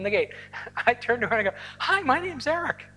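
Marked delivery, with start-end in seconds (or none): 1.8-2.9 high, chirpy mock-friendly hello; acted-out sociable hello, pitched way up; friendly greeting. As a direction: re-enact an eager, friendly introduction in a higher voice.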